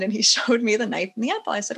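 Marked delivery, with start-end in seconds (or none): none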